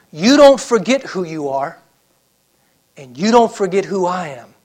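loud, emphatic warning as God; preacher thundering a command; forceful divine command. Acted. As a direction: drive it home with full voice. God warns Jacob, voiced by preacher.

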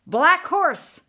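high, loud witch's order; loud command. A narrator's reading. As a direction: bark the horse's name. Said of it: "the witch ordered".

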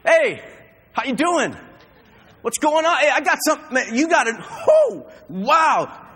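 loud, high, jubilant proclamation; bold shouting preacher. Acted. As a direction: shout it like you're on fire. Spirit-filled bold believer.

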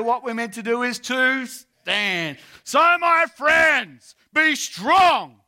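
loud, high-pitched rallying sermon; full-force preaching. Real, not acted. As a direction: preach it out to the whole hall.

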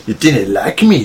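slow, badly faked Scottish accent; terrible Scottish accent. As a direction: put on a hopelessly bad Scottish accent. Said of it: "the worst Scottish accent ever".

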